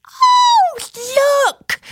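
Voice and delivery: very high squeal of delight; high, drawn-out "oh" of wonder; excited child's cry. A narrator's reading. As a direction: cry out like a thrilled little girl. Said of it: "cried Percia".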